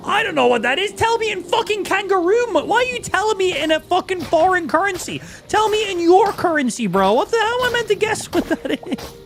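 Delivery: very high, exasperated mock rant; playful yelling. Streamer trolling stream chat over foreign currency, mock yelling. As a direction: yell in pretend outrage, voice way up. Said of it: "entertain myself by yelling at stream chat".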